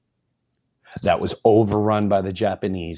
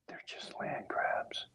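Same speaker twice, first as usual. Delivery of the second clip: very soft, slow, fully whispered; flashback whisper; 18 dB quieter, slower than usual. Retold or imitated. Dad in the flashback ignores son, slow whisper. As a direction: breathe it out in a hidden whisper.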